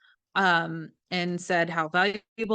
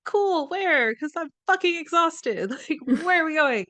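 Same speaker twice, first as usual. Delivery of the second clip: much higher, laughing put-on enthusiasm; mock eager tired self; 9 semitones higher, 3 dB louder than usual. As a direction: fake pep that cracks into a laugh. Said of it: "I'm like".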